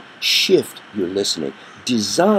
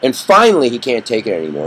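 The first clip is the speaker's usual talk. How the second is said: ordinary talk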